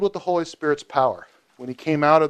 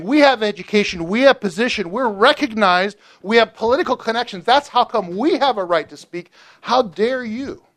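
higher, pompous voice of the elite; haughty religious leaders; 6 semitones higher than usual. Retold or imitated. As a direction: puff up and look down on him. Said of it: "this is how they would say the thing".

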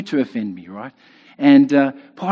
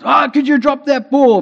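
high, loud imitation of rugby fans; imitated spectator's shout, pitched up; mock sideline shout; 11 semitones higher, 7 dB louder than usual. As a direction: yell at the TV like an exasperated armchair fan. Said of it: "They shout".

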